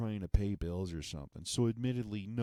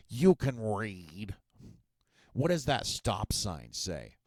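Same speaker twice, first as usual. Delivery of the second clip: slow, louder, over-patient mock skeptic; slow condescension; 5 dB louder, slower than usual. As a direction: spell it out as if to a child.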